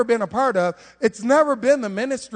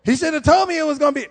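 amazed; 6 semitones higher, 4 dB louder than usual. Retold or imitated. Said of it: "You be like".

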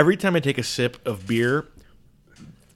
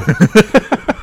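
loud high laugh; 10 semitones higher, 10 dB louder than usual. Real, not acted. Overwhelmed by the spicy heat.

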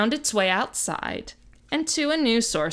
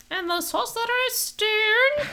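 much higher, slow, jokey Scottish voice; mock Scottish accent; 12 semitones higher, slower than usual. Host finishes the book title in a mock Scottish accent. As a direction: stretch out the title in a silly Scottish lilt. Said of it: "that's my Scottish accent".

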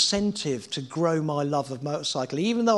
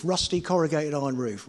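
ordinary talk

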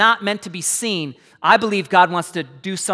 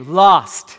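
getting louder; 5 semitones lower, 4 dB louder than usual. Shown as a demonstration. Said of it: "Come on, I can't hear you".

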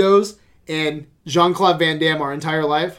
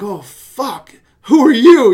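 startled who are you; 7 semitones higher, 9 dB louder, slower than usual. Retold or imitated. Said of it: "he just looks at him like".

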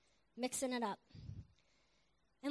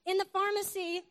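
acted spousal grilling, raised voice; mock interrogation; 6 semitones higher, 6 dB louder than usual. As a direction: confront him as an astonished wife. Wife confronts husband, acted.

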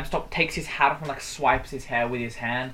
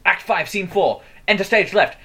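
loud, higher, declaimed stage directions; giving out stage directions; 5 semitones higher, 6 dB louder than usual. Retold or imitated. Imitating the stranger at the tram stop, declaiming stage directions. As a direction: announce it like a hammy actor playing both parts. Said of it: "giving out stage directions".

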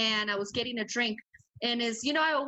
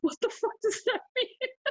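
incredulous friend; 12 semitones higher than usual. Retold or imitated. Worried friend reacting in club.

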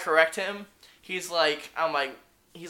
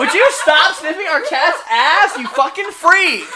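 high, loud, drawn-out telling-off; exasperated, half-laughing shout; laughing scolding; 10 semitones higher, 7 dB louder, slower than usual. Real, not acted. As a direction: yell it slowly in amused disbelief. Scolding someone sniffing the cat.

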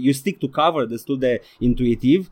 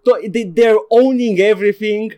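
giddy excitement; 7 semitones higher, 7 dB louder than usual. Real, not acted. Still excited after the outburst.

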